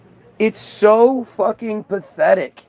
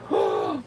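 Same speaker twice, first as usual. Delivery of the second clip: sharp fake gasp of surprise; mock gasp; 8 dB quieter than usual. Streamer feigns shock at a coincidence, mock gasp.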